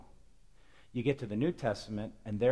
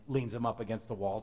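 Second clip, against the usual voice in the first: ordinary talk, nothing marked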